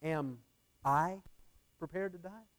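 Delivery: single out I, then lift the question; stress on I. Shown as a demonstration. Preacher shows how inflection changes the question, stress on I. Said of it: "emphasis on each word".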